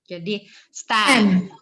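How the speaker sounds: stem said as stam, slow and loud. Shown as a demonstration. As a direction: stem with an open A vowel. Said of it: "Itu A-nya bacanya".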